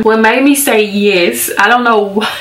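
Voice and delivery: ordinary delivery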